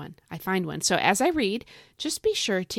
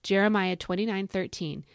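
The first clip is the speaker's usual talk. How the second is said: ordinary talk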